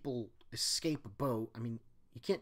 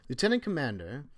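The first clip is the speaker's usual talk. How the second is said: put-on German accent; 12 dB louder, faster than usual. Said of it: "I can't do this accent".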